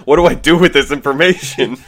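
loud, high mock exasperation; freaking-out unicorn. Co-host joins in as the sorting unicorn, loud mock freak-out. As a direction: play a creature overwhelmed and at a loss. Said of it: "the unicorn starts freaking out".

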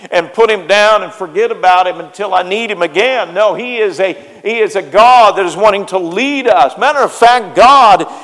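loud, high, rising sermon fervor; preaching at full force. Acted. Pastor rousing the church about living for God, loud and fervent. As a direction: drive it home to the congregation.

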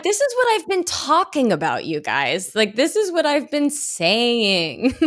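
high-pitched, drawn-out exclamation; mock-exasperated vindication, stretching the last words; vindicated outburst. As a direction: proclaim it high, finally proven right. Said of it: "I'm like".

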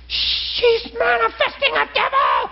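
high, slow, frantic shout; the caller crying out the news; alarmed shout. Retold or imitated. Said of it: "panicked caller".

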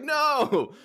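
high, loud mock cry of dismay; frustrated shout. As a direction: yell no at a missed shot. Lobbing the ball by mistake.

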